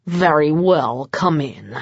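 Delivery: low, slow, grudging welcome; ungracious. A narrator's reading. Vlad grudgingly admits his guests, low and slow. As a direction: let him in through clenched reluctance. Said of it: "far from gracious".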